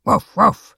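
two loud imitated barks; dog's double woof. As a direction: bark twice in reply, loudly. The dog answers its owner.

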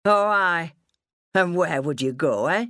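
mildly mocking father; a touch of scornful challenge; sarcastic. A narrator's reading. Father doubting daughter will leave, lightly sarcastic. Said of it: "he gave a sarcastic, humourless laugh".

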